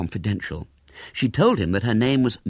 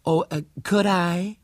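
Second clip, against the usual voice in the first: high, slow, sweetly hesitant; sugary American lady; disarming sweetness; 11 semitones higher than usual. A narrator's reading. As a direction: ask sweetly to win someone round. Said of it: "said Miss Burford with disarming sweetness".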